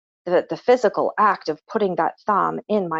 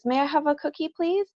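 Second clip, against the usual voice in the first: put-on high, sweet little-kid voice rising up; polite child asking; 9 semitones higher than usual. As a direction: play the small boy asking nicely. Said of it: "he says".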